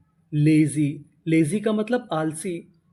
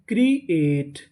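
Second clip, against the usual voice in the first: Create split into two separate parts; a small break inside create; syllable by syllable; 2 semitones lower, much slower than usual. Shown as a demonstration. Teacher sounds out a word for learners, create in two pieces. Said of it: "create का मतलब".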